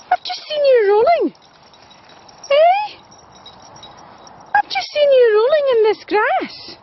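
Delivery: very high, fond mock-scolding pet voice; doggy talk. Real, not acted. Owner teasing her spaniel for rolling in grass, high sing-song. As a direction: tease the dog sweetly for rolling.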